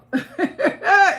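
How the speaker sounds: sudden loud laugh, high-pitched; a big defiant laugh; loud high cackle. Real, not acted. Laughs after vowing never.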